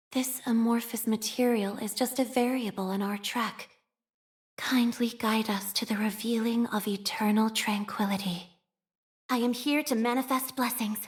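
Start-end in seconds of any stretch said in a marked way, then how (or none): 4.6-8.5 whispered prayer. Acted. Operator whispering a prayer for tranquility. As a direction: pray under your breath.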